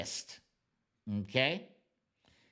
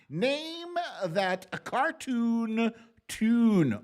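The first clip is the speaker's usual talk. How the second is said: game show announcer; 8 semitones higher, 5 dB louder, much slower than usual. Acted.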